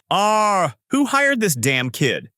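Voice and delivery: high, drawn-out cry of frustration; angry yell. A narrator's reading. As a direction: vent exasperation at the top, then slow down. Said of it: "He gets angry ... as he yells".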